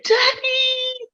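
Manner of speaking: put-on high, delighted little girl's call of Daddy; excited child calling daddy. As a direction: call out like a spoiled nine-year-old seeing her father. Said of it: "and I was like".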